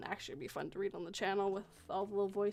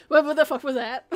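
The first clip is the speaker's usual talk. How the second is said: loud, higher mock alarm; startled outburst; 5 semitones higher, 14 dB louder than usual. Playing a listener woken by a voice, startled outburst. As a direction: blurt it out as if jolted awake.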